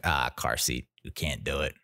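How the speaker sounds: a hint of a tough crook with a conscience; robber voice. Robber finding a car seat, low mock voice.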